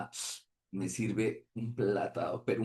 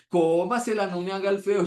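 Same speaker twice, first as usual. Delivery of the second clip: put-on high, louder coaxing old lady; insistent hostess; 9 semitones higher, 6 dB louder than usual. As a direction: push the food on him sweetly. Host insists again. (señora que insiste en que coma, aguda)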